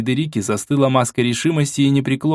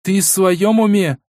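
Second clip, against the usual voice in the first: almost shouting; 8 semitones higher, much slower than usual. Acted. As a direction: nearly scream it in disbelief. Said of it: "практически прокричала Фредерика".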